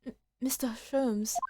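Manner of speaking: soft, slow near-whisper; hushed concern. Acted. Companion addressing troubled Mister Sholmes, hushed near-whisper. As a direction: falter softly on the name, almost voiceless.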